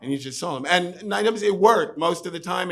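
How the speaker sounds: ordinary talk, nothing marked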